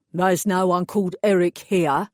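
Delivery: a burly East End mechanic taking over; East End accent. Acted. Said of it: "distinctly East End accent".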